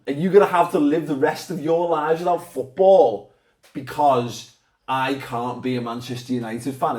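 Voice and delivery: ordinary talk, nothing marked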